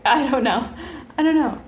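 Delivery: loud laughing shrug-off. Real, not acted. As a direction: burst out laughing while speaking. Laughing at own blunt swearing.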